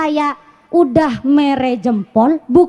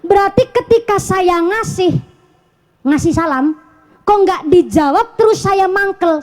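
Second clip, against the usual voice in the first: ordinary delivery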